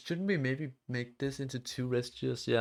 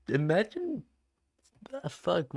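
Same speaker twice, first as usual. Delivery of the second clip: a real crack in the voice; 5 semitones higher than usual. Real, not acted. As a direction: voice breaks mid-word. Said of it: "my voice just fucking cracked".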